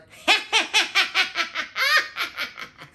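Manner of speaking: high, loud witch cackle. Shown as a demonstration. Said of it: "when you laugh like a witch".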